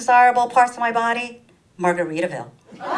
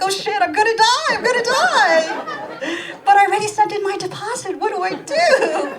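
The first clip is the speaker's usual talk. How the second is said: much higher and louder, hammed-up panic; mock panic; 13 semitones higher, 5 dB louder than usual. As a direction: squeal it like a comic meltdown. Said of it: "I'm like".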